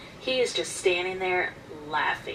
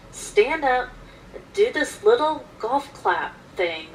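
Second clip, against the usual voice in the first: usual speaking voice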